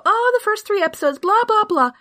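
high, whiny mock complaint; mocking fandom voice. Host mocking fandom complaints in a high whiny voice. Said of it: "chatter within the fandom about how".

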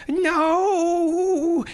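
high, loud anguished no; anguished cry. A narrator's reading. Boy crying out as he writhes, anguished scream. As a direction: cry out in agony. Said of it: "he cried".

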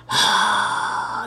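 long, breathy mock sigh; drawn-out fed-up exhale; exasperated sigh. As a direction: imitate an eye-rolling huff. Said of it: "she rolls her eyes".

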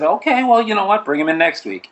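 high quoted voice, lightly acted; casting director quote. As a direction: quote her, pitched well up. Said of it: "she said".

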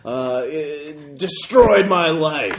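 booming bitter roar. Acted. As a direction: roar out a ruined life. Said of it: "that same booming voice".

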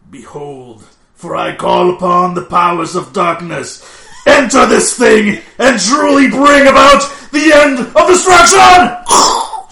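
slow, building to a huge high shout; yelled attack. Acted. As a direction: bellow the spell dramatically. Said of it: "you have to yell out your attacks".

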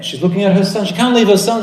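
normal talking voice

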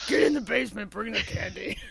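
creepy basement guy voice. Acted. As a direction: lure them in like a creep.

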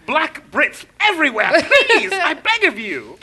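high, theatrical begging; mock pleading. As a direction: implore her with hammed-up desperation. Acted plea to Meghan continues.

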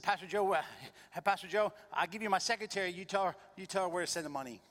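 brisk, helpful friend on the phone; Charlie's voice. Retold or imitated. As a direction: caller offers his secretary, high and loud. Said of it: "Charlie's Korean".

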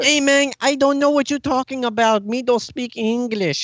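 badly faked accent, somewhere between Portuguese and Mexican; attempted Portuguese accent. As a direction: put on a clumsy Portuguese accent. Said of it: "what accent you were trying to do".

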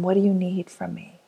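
ordinary talk, nothing marked

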